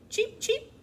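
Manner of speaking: high, loud chick cheeps; squeaky, piercing little peeps; peeping chicks. A narrator's reading. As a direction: chirp sharply like baby chicks. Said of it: "the chicks peeped".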